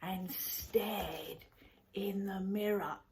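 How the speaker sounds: usual speaking voice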